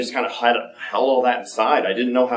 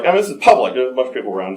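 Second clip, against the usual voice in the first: normal talking voice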